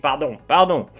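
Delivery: ordinary talk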